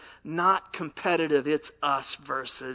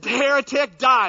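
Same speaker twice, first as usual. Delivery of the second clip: mock fury; 9 semitones higher, 10 dB louder than usual. Punchline, man turns on other.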